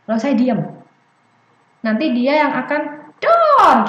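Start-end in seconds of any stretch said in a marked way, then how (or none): 3.2-3.8 high, loud, drawn-out bang; shouted pop. Retold or imitated. Child completing the balloon song, gleeful shout. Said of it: "kan ada bagian dor itu".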